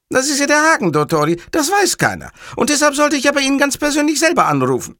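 very high, earnest underling; hapless caller Cattarella. Acted. Cattarella explains why he had to call, very high and earnest. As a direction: explain it in a high, eager character voice.

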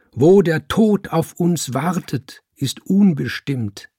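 calling out. Acted. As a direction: call it out with conviction. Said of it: "rief er".

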